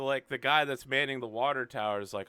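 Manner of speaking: ordinary delivery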